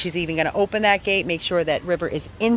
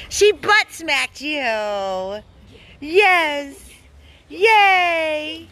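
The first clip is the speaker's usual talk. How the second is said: delighted cheer; 8 semitones higher, much slower than usual. Real, not acted. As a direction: celebrate the dogs' play. Cheering the dogs playing.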